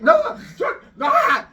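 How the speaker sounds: high, loud, broken-off panic; freaking out. Retold or imitated. Storyteller as crowd seeing the hanging, freaking out. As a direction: sputter in mock alarm, words tumbling unfinished. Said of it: "freaks out".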